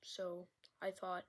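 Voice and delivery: hushed relaxing whisper for a sound video; ASMR whisper. Real, not acted. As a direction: whisper close to the mic as if lulling them to sleep. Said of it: "Why am I, why am I whispering?".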